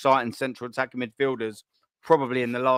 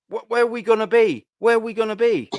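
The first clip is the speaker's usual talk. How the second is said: exasperated cry; 6 semitones higher, 3 dB louder than usual. Real, not acted. Fan ranting, pitch up.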